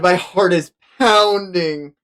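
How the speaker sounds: ordinary talk, nothing marked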